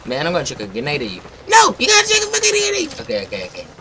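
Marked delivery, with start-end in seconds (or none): 1.5-2.9 fast, very high protest; high-pitched voice. Acted. Player arguing over a grenade, high-pitched voice. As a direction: fire back fast, way up high. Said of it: "why the fuck you doing some high-pitched ass voice?".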